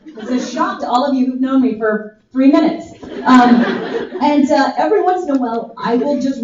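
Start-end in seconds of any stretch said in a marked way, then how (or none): none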